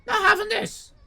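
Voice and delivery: high, loud indignant protest; indignant refusal. Retold or imitated. Customer refusing lasagna at ten in the morning. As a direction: refuse in outraged disbelief. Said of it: "And I said".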